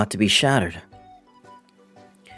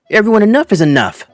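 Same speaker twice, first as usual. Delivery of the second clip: raised, firm teacher voice; exasperated call to order; 4 semitones higher, 5 dB louder than usual. Acted. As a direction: call the class to order, louder. Teacher scolds students ignoring him.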